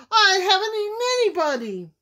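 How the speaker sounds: high, fast wolf wail; indignant high-pitched protest; howling protest. A narrator's reading. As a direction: howl your innocence. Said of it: "he howled".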